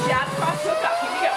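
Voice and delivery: boxing coach calling combos. Shown as a demonstration.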